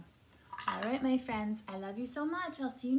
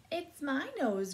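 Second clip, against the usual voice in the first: grumble; 8 semitones higher, 7 dB louder than usual. A narrator's reading. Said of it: "grumbled the rhinoceros".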